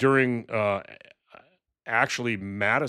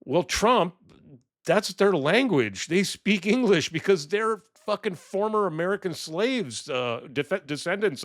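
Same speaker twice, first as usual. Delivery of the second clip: exasperated retort; 7 semitones higher than usual. Real, not acted. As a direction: hit back at Trump in disbelief. Angry retort to Trump.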